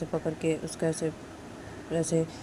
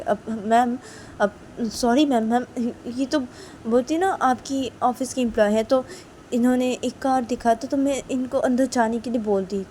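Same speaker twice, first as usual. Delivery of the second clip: higher, nervous explaining voice; apologetic guard; 7 semitones higher, 4 dB louder than usual. Acted. Guard nervously apologizing to his angry madam. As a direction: excuse yourself to the boss. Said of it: "एक guard ... आके बोलती कि".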